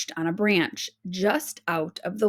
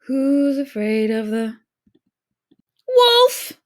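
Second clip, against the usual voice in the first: song breaking into high loud wolf; startled shriek on the last word; shocked cry; 4 semitones higher, 7 dB louder than usual. A narrator's reading. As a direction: sing then yelp in fright. Wolf appears mid song.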